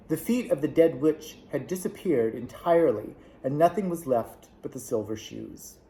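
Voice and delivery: usual speaking voice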